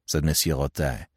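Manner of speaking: ordinary delivery, nothing marked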